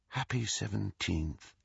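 slow, softened birthday wish; soft greeting. A narrator's reading. Ginny greets Harry on his birthday, softly and slowly. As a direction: say it quietly and slowly.

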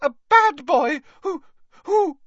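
high, loud elf stammering with outrage; angry squeak. A narrator's reading. Dobby denouncing Draco Malfoy, angry faltering squeak. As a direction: squeal it, breaking off as words fail. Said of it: "squeaked Dobby angrily".

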